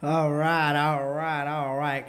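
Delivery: loud drawn-out all right; hyping the crowd. Real, not acted. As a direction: call out to rev up the room. Hyping the crowd after poem.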